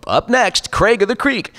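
high, upbeat promo announcer; cartoon network promo. Shown as a demonstration. Announcer between cartoon shows, network promo voice. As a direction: announce the next show high and punchy. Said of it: "the stuff that you hear in between the shows".

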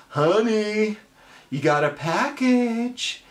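high sing-song call from the wife. Retold or imitated. Wife calling her husband about a package, high sing-song call. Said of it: "your wife, she yells".

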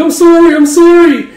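very high, loud squealed apology; mock frantic pleading; panicked apology. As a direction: plead frantically like a caught child. Children caught, pleading apology.

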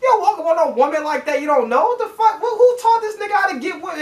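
high-pitched, indignant outburst; appalled disbelief. Real, not acted. Berating a man who walked up on a woman, high and appalled.

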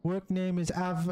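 halting reading. Real, not acted. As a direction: stumble through the document. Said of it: "too much reading for me".

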